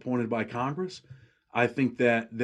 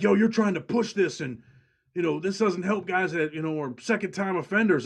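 higher, pushy imitation of his friend's objection; imitating his friend; 7 semitones higher than usual. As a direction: lightly mimic him pushing back. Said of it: "where you're like".

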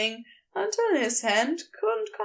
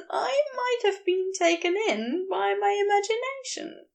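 higher, heated fussy angel voice; Aziraphale, wild; 8 semitones higher than usual. A narrator's reading. Angel ranting at the geologist, high and heated. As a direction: raise the pitch and let him rant.

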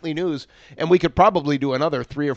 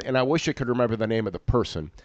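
normal talking voice

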